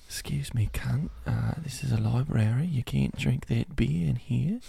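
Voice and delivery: soft, hushed Australian librarian; subdued Australian accent. Acted. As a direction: shush a patron in a quiet Aussie accent. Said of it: "a more subdued Australian".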